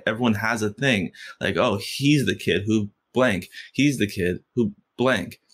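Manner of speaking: ordinary delivery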